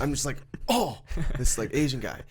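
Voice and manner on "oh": imitated startled "oh", higher pitched; startled cry. Retelling being hit with a cart at work, startled. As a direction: jolt on a sudden bump, retold.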